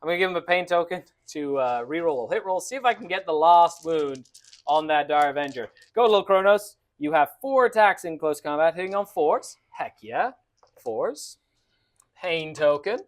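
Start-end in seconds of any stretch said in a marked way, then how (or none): 5.9-6.8 louder, higher cheer. Real, not acted. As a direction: cheer the model on. Cheering own model on.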